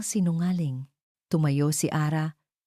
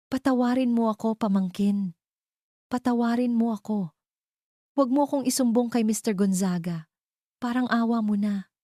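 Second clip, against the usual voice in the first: begging; 7 semitones higher than usual. Acted. Aunt kneels begging forgiveness.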